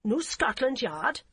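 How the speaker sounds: put-on slow, rising German-accented repeat; German-accented query. Policewoman repeating it back, puzzled, in a heavy German accent. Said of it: "the woman repeated".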